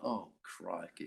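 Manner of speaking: low, slow, soft sinking aside; quiet dismay. Retold or imitated. Nervous actor waiting after a brilliant rival, quiet dismay. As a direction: let it sink under your breath.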